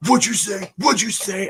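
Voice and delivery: high, loud mock-threatening demand; mock bully shout; aggressive bully. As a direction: bark it like a kid looking for a fight. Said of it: "he's just like".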